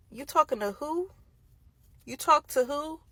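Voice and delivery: high, challenging questioning; acted-out incredulous challenge; mock confrontation. Acting out confronting her boyfriend.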